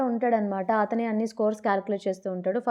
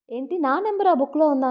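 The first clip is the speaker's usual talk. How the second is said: higher, louder surprised question; retold surprise; 5 semitones higher, 5 dB louder than usual. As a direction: ask it as if mildly taken aback. (ఆశ్చర్యంగా అడిగినట్టు)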